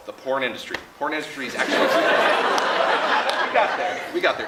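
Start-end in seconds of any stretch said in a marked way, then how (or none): 1.8-4.0 laughing. Real, not acted. Laughing at the segue.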